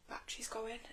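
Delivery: a quick private note to herself; whispered aside. Real, not acted. Noticing the phone battery dying mid-live, whispered aside. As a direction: whisper it under her breath.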